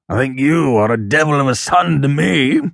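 father's indignant retort; crying out. A narrator's reading. Father rebuking his favourite son, crying out. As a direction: cry out in mild anger. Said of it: "cried his father".

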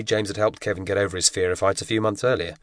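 ordinary delivery, nothing marked

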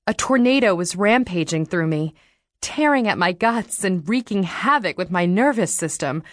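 ordinary delivery

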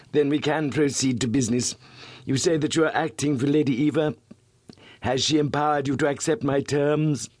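a hint of a sly blackmailer; lightly voiced silky villain; smooth suave voice. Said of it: "His voice was as smooth and suave".

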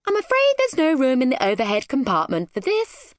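high, haughty cabin crew voice; flustered, snooty flight attendant. A narrator's reading. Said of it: "rather flustered and snooty flight attendant".

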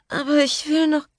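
high, whining tipsy protest; whiny drunk. Acted. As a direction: whine it like a drunk child. (hoch, quengelnd und betrunken)

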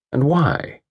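ordinary delivery

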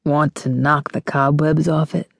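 husky suggestive male voice. A narrator's reading. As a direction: proposition her in a husky voice. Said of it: "His voice got all husky".

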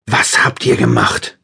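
slow, loud, anguished cry; beside himself shouting. A narrator's reading. To the men who hurt his girlfriend, a slow, loud, anguished shout. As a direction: hurl the question at the torturers, beside yourself. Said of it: "schrie ich außer mir".

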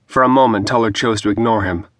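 ordinary delivery

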